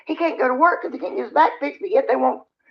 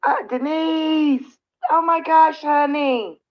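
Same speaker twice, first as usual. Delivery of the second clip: higher, tender, slowed and emotional; touched thanks; 6 semitones higher, slower than usual. Real, not acted. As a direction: thank her, moved close to tears. Thanking a viewer for gifts.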